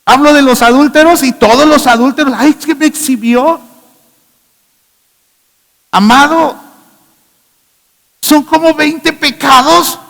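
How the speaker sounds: voz alta y fuerte; predicación a gritos. Acted. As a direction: predica con fuerza. (sermón gritado)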